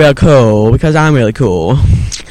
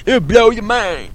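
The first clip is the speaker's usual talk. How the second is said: high-pitched excited plug; mock hype; 8 semitones higher than usual. Hype line for coming episode.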